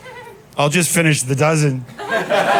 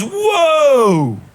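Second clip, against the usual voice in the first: alarmed whoa; 11 semitones higher, 4 dB louder than usual. Retold or imitated. Cousin objects to "gypped.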